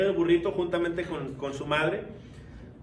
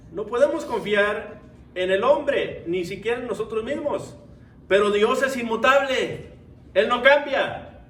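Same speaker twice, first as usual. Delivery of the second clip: high, emphatic sermon delivery building louder; forceful preaching; 7 semitones higher, 5 dB louder than usual. Real, not acted. Preacher contrasts changeable man with unchanging God, preaching forcefully. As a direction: drive the point home to the congregation.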